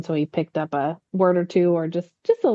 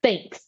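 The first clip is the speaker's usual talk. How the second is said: "thanks" drawn out with weight; emphasized THANKS. Retold or imitated. Wife showing husband's emphasis, stressed THANKS. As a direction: lean hard on the word thanks. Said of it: "he emphasizes thanks".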